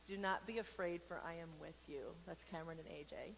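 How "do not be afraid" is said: ordinary delivery, nothing marked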